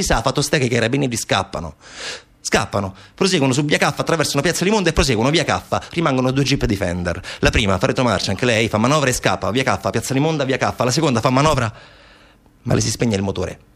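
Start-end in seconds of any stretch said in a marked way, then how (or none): none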